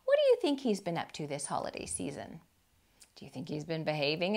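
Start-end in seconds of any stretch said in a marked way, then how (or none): none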